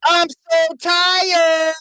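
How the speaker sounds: high, slow whiny wail; drawn-out fake sob voice; mock whiny crying. Mocking crying guest.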